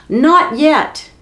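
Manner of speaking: high, loud urgent call; exclaiming. Acted. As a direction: cry out to stop them, high and loud. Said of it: "Beatrix the butter maid exclaimed".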